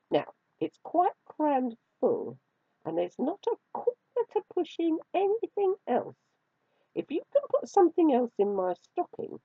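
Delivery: playfully lording storybook cat; teasing kitten. A narrator's reading. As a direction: toy with the mouse in a high, swooping voice. Kitten sets the mouse a challenge.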